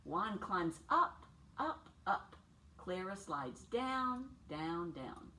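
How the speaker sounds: story-time voice, drawn out and lively; playful slow read-aloud. A narrator's reading. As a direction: stretch each word out like climbing and sliding. Reads picture book aloud.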